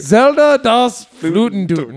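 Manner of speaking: put-on slow, laughing German accent; movie villain german accent. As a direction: savor the title in a hammy German accent. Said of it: "Why are you doing a German accent?".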